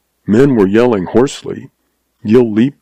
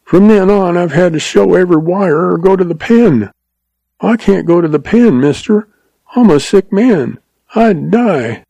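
blubbering, much higher; frightened blubbering confession; 9 semitones higher than usual. A narrator's reading. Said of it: "blubbered the agent".

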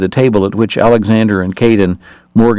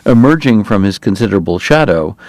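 usual speaking voice

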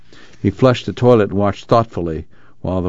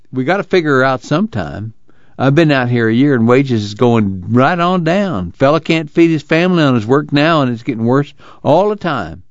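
higher-pitched, aggrieved farm worker; troubled Willie; 6 semitones higher than usual. Acted. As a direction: complain in a rural voice, swooping upward.

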